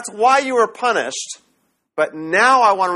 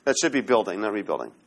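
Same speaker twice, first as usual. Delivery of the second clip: soft correction; 10 semitones lower, 7 dB quieter, faster than usual. Real, not acted. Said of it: "I should say building there".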